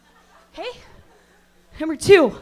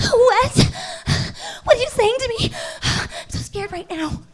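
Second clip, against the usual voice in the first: mock fright; 6 semitones higher than usual. Mock Blair Witch monologue, high gasping fright. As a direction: play hammed-up terror. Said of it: "That was a monologue from Blair Witch Project".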